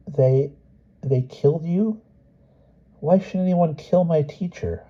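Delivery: put-on high, dazed child voice; boy's voice, numb with shock. A narrator's reading. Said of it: "leaving him numbed and saying".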